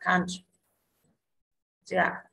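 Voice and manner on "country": "country" stressed on COUN; stress on first syllable. Shown as a demonstration. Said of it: "trọng âm rơi tiết thứ nhất". (nhấn âm tiết đầu "country")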